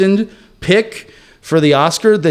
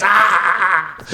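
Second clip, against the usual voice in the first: wild crazed shriek; maniacal cry; 5 semitones higher than usual. Acted. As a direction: let out an unhinged scream. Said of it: "my most maniacal entry ever for this".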